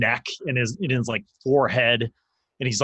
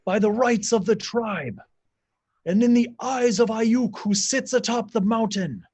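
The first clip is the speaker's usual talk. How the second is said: much higher, ringing ritual command; shaman commanding silence; 12 semitones higher than usual. Acted. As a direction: silence the crowd and invoke the god. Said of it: "the shaman's like".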